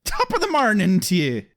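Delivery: hammed-up stage Irish accent; mock Irish accent. Host toasting London beer in a mock Irish accent. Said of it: "Wrong accent".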